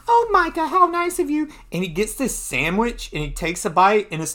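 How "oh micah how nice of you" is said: high, gushing hostess squeal; Debbie the socialite. Acted. Hostess to her guest of honor, high gushing voice. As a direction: gush in a very high lady's voice. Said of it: "Debbie, the guest of honor".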